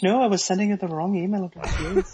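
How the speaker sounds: mock prim reply. Imitates Kelly's reply.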